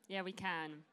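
usual speaking voice

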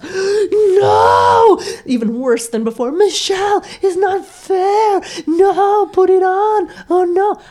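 high, loud wailing protest; a Sofia Vergara-like shrill wail; whiny outcry. Retold or imitated. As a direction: howl like upset teens. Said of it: "sound like Sofia Vergara".

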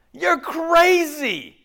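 high, loud and slow, stretched out in wonder; drawn-out astonished you're crazy; amazed disbelief. Retold or imitated. Preacher voicing a friend's amazed reaction, slow and high. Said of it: "someone said that to you".

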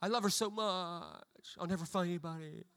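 slow, mopey complaint; whiny heartbroken teen.

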